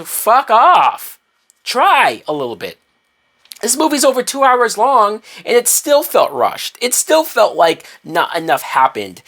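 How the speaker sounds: high-pitched, fed-up outburst; exasperated rant. Real, not acted. Reviewer fed up with a rushed two-hour movie, exasperated. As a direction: let the exasperation push your pitch right up.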